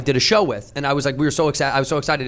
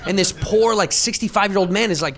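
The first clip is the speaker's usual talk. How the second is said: ordinary delivery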